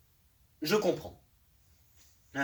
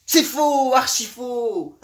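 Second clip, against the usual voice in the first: loud, high protest, last words drawn out; indignant outburst; 5 semitones higher, 4 dB louder, much slower than usual. Acted. Monologue-hater denouncing people who talk alone, indignant outcry. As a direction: declaim your outrage, stretching the end.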